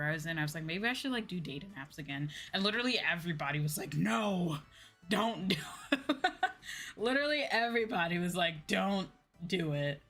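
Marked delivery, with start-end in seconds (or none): none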